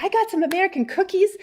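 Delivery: high, quick, excited announcement; eager offering. Retold or imitated. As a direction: imitate a host proudly offering treats. Acts host proudly offering cookies.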